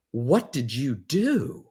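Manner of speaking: slow, mildly accusing question. Retold or imitated. Wife turning on her husband after hearing they can retire, mildly accusing. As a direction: let it land slowly as a light accusation. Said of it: "accusing him of doing something wrong".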